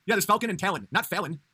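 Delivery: usual speaking voice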